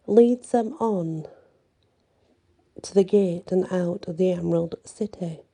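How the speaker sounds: usual speaking voice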